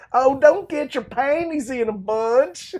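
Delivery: high, slow, loud mock Southern drawl; mock Southern accent. Mocking Lance's dad's awful accent, hammed-up Southern drawl. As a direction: ham up a bad Southern dad accent. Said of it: "worst Southern accent of all time".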